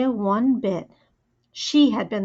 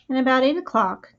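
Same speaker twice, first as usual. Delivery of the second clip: usual speaking voice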